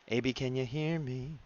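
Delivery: slow, stretched-out check-in; drawn-out calling. Pretend. Streamer playfully checking whether Abe can hear, drawn-out calling. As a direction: draw out each word as if over a bad line. Mock calling out to absent chatter.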